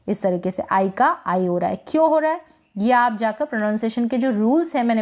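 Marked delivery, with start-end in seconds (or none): none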